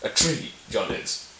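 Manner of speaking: "tree" pitched high and stretched; slow delivery, leaning on TREE; stress on TREE. Shown as a demonstration. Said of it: "really putting emphasis on".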